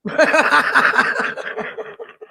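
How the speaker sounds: high burst of laughter; real cackling laugh that tails away; big laugh. Real, not acted. Reacting to a friend's fire-setting confession, big laugh.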